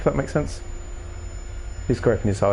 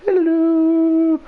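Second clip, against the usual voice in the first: high, loud put-on greeting; silly high hello; 5 dB louder than usual. Gamer greeting a stranger in a hat, high cartoon hello. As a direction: chirp a cheery cartoon hello.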